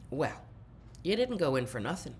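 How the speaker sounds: ordinary talk, nothing marked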